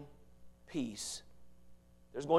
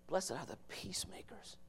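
breathy whispered beatitude, rising at the end; 4 semitones higher, 11 dB quieter than usual. Real, not acted. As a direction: whisper it like a secret. Whispered repeat.

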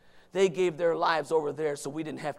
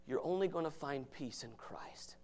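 hushed whisper; 11 dB quieter than usual. Real, not acted. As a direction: drop to a whisper to pull them close. Preacher drops to whisper.

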